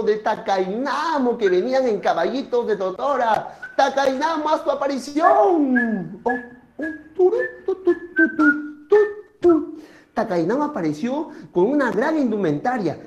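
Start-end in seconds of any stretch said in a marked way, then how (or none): 3.8-6.2 higher, drawn-out announcer call; grand summons. Acted. Storyteller summons Takainamo to appear, drawn-out and high. 6.2-9.8 high tooting entrance sound; short sung-out toots; imitated fanfare. Storyteller heralds Takainamo's entrance, mock fanfare.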